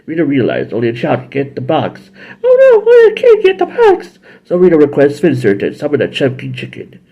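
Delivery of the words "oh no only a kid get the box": very high, loud witchy villain. Retold or imitated. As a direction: cackle through it like a cartoon witch. Rita voiced.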